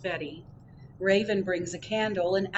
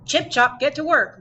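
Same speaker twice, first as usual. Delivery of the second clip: bossy call; 7 semitones higher, 10 dB louder than usual. A narrator's reading. Mole urging friends to work.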